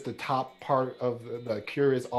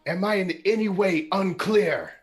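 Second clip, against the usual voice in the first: commanding threat; 7 semitones higher, 5 dB louder than usual. Acted. Warlord threatens villagers.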